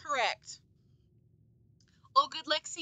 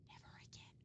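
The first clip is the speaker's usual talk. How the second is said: barely audible whisper; whispered vow; 28 dB quieter than usual. Real, not acted. Gamer relieved after the checkpoint, whispered vow. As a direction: murmur it to yourself, almost no voice.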